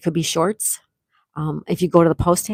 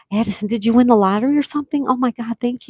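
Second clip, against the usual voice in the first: higher, rapid amazed question; amazed and fast; 5 semitones higher, faster than usual. Real, not acted. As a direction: rattle it off in disbelief. Viewer keeps gifting memberships.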